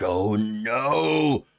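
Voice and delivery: long, hammed-up wail of dismay; mock horror. Host on sister's child out of wedlock, mock horror. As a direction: draw it out in pretend shock.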